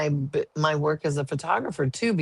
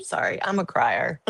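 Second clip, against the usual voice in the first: choked up; 3 semitones lower than usual. Real, not acted. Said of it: "I'm gonna get choked up".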